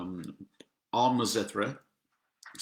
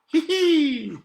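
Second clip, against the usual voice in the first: giggle; 8 dB louder than usual. Acted. Giggly squeal after famous roll.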